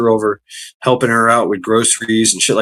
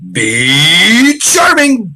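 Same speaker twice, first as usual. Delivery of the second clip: slow, stretched, higher and louder name call; drawn-out greeting; 8 semitones higher, 5 dB louder, much slower than usual. Acted. Greeting chatter B Charming with a long drawn-out call. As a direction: sing the name out long to welcome them.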